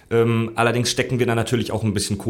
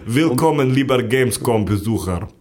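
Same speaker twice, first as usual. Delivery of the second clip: put-on Russian accent; 3 semitones lower than usual. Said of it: "Synchronsprecher mit russischem Akzent".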